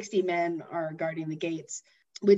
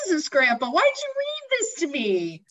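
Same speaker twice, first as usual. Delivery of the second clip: very high, loud whine; exasperated little boy; 9 semitones higher, 3 dB louder than usual. Retold or imitated. Boy scolding grandpa for the story, high whine. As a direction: complain like a betrayed kid. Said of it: "And he's like".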